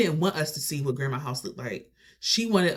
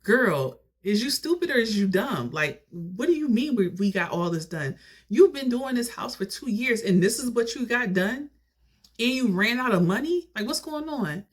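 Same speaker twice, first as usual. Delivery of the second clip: high-pitched, indignant questioning; incredulous confrontation; 8 semitones higher than usual. Retold or imitated. Relatives confronting D over two years of work, incredulous. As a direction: act out calling her out in disbelief. Acted family confronting her.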